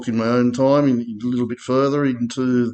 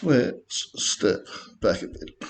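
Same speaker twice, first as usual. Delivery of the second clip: slow, breathy hush, much softer; hushed retake; 7 dB quieter, slower than usual. Shown as a demonstration. Trader redoing his line for viewers, slow soft hush. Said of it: "Maybe not quite like that".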